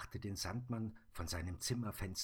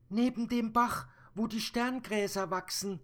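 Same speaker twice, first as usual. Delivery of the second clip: put-on high, louder child voice; brave little girl; 9 dB louder than usual. Little girl telling the Sandman about the monster, high and loud. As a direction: speak as a bold small girl.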